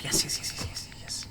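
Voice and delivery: whispered, fading run of yeses; gleeful whisper. Pretend. Player delighted at finding a charm notch, gleeful whisper. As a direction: hiss the find, then whisper yes rapidly, trailing away.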